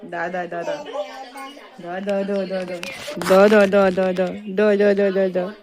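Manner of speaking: put-on loud playful baby babbling; baby babble. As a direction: babble back like the baby does.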